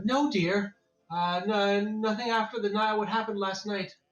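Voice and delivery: woman reassuring husband. A narrator's reading. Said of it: "the woman says".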